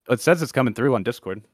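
normal talking voice

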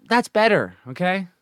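ordinary talk

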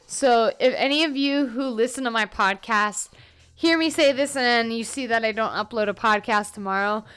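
ordinary delivery

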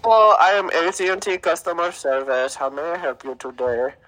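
put-on Indian customer service caller; mock Indian accent. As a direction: play a phone agent with an Indian accent. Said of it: "I hear some Indian accent".

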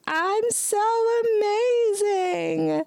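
high, stretched self-praise; mock diva voice. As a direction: gush over yourself in a silly high voice. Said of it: "and I was like".

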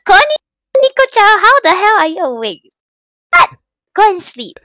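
high loud playful greeting; excited greeting. Real, not acted. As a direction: call out to chat brightly. Greeting chat viewers in high voice.

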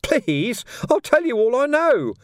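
very high, pleading squeal; desperate high-pitched begging; frightened squeak. A narrator's reading. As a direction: squeak it out in terror. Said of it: "Ferret squeaked".

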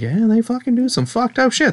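high-pitched, insistent agreement; emphatic agreement. Retold or imitated. Answering the critics' hedging, emphatic retold agreement. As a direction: jump up in pitch to drive it home.

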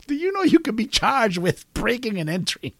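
high put-on child voice; imitated kid. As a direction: pitch up as a kid challenging Santa. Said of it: "And he says".

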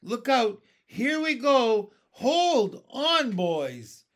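high, very loud shouts; stretched, full-voiced cries; excited warning yell. A narrator's reading. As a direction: yell for everyone to brace. Crew yelling as peach rises.

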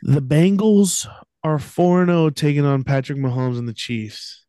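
lightly voiced arena announcer. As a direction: build it up like an MC intro. Introduces game like an announcer.

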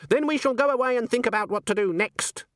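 very high, coolly threatening witch; Granny. A narrator's reading. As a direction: deliver a veiled threat in a high voice.

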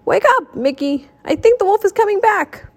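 high, urgent call; alarmed wake-up call. A narrator's reading. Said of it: "he called".

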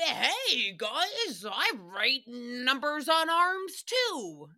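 a hint of a goofy Rick voice; imitating Rick. Imitating Rick popping up on the mic, slow and goofy. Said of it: "You're like".

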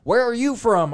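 loud, high, incredulous question; mock-bewildered outburst; baffled disbelief. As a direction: demand it as if you can't believe it. Retold bewildered reaction to accent.

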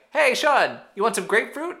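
high, loud mock-friendly taunt; needling, put-on cheeriness; snarky Boston Rob. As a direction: tease in a high singsong offer. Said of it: "Snarky-ass Boston Rob".

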